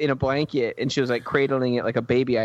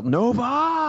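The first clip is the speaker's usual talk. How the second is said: high, drawn-out call; mock yell; 12 semitones higher than usual. She calls out for Nova, high mock yell. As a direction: call the name out high, hamming it up. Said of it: "she can just yell".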